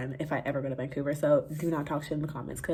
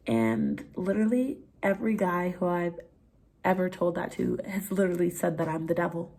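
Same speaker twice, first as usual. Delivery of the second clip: ordinary talk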